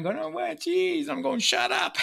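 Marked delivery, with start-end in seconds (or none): 0.2-1.1 high-pitched mock wail of a little girl; crying child. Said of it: "the girls are sitting there crying". 1.4-2.0 high-pitched retold snap; exasperated parental outburst. Dad snapping at crying daughters.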